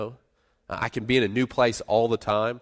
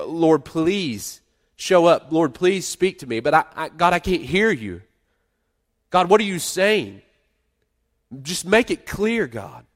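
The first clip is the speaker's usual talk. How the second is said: desperate plea; 6 semitones higher, 5 dB louder, slower than usual. Acted. Pleading prayer acted out.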